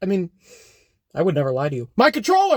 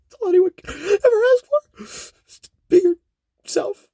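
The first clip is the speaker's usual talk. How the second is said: mock crying; 11 semitones higher than usual. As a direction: voice cracks high into a hammed-up sob. Mock emotional sign-off speech.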